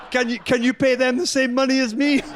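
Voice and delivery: high mock French accent dissolving into laughter; Johnny asking Severine to pay his teammates, in mock French accent. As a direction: laugh through a put-on French accent, pitched well up. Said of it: "put on the same French accent that I'm putting on".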